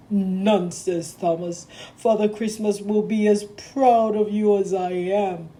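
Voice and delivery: stern boss. Acted. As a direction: speak as the firm railway boss giving an order. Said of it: "said Sir Topham Hatt".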